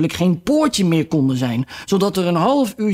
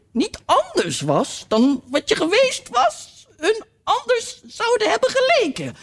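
duchess voice. A narrator's reading. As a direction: lose yourself in the tangled moral. Duchess moral rambling.

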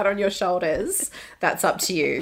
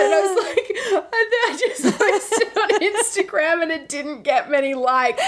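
mock crying; 9 semitones higher than usual. Reenacting her own tearful confession.

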